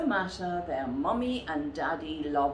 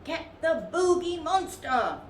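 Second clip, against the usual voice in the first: high, bossy little bear voice; a tiny bear commanding his gang; small bear's order; 12 semitones higher, 4 dB louder than usual. A narrator's reading. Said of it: "ordered the little one".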